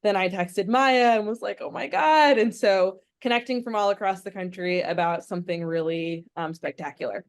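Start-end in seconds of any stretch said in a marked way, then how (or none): 1.6-2.4 re-enacted burst of excitement, higher and louder; excited exclamation. Texting a friend about the nomination news, excited. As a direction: quote a thrilled reaction, lifting the pitch.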